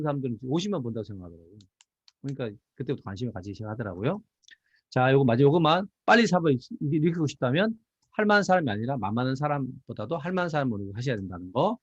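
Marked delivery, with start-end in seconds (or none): none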